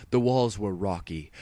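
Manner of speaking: plain reading voice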